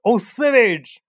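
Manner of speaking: high, loud cry of alarm; alarmed exclaim. Acted. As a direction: burst out in shock. Dad exclaims at savage animals.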